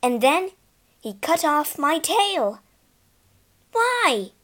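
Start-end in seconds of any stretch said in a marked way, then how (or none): 3.7-4.3 high-pitched shocked question; crying out. A narrator's reading. Horse hearing of Ginger's cut tail, crying out. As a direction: cry it out in dismay. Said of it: "I cried".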